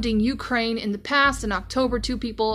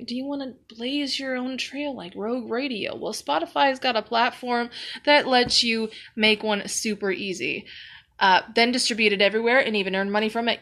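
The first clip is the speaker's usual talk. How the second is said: upbeat sponsor read. Acted. Host reading a Spotify sponsor spot, light ad read. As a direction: sell it like a podcast ad.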